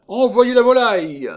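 high, loud ringing call; shouting. Acted. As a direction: call across the room. Cascadin calling out to the studio.

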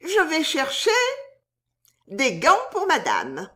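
high-pitched maid's voice; soubrette. A narrator's reading. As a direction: answer as a maid, high then coming down. Maid answering her suitor. (voix aiguë de soubrette)

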